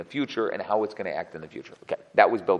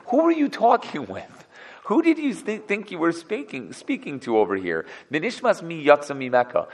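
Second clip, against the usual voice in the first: incredulous; 7 semitones higher than usual. Retold or imitated. Elihu's incredulous questions to friends.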